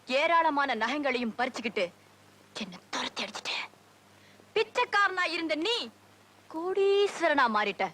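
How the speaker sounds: high-pitched, indignant reproach, slowing at the end; bitter accusation. Acted. Cheated woman accusing the man who took her wealth, bitter accusation.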